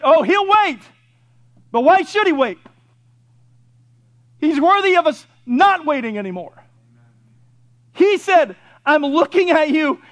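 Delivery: high, forceful pulpit challenge; urgent preaching. Acted. Preacher voicing God who is waiting on sinners, urgent full-force preaching. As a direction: hammer each question at the congregation.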